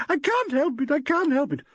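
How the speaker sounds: a weeping actress protesting; wailing. A narrator's reading. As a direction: wail helplessly. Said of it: "wailed Valerie".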